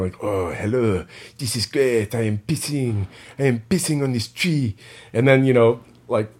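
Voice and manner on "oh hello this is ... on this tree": put-on imagined dog with a French accent. Musing man voicing a park dog in his head, French accent. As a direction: put on a playful French accent, pitch rising as it goes. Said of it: "like, a French accent in my mind".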